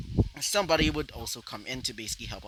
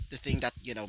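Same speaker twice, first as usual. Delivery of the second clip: plain reading voice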